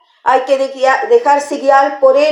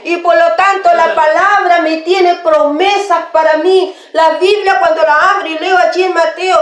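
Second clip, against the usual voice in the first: higher-pitched, driving preacher's voice; fervent preaching; 6 semitones higher than usual. Acted. Preacher assuring the afflicted that God is with them, preaching fervently. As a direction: proclaim it with insistent, mounting fervor.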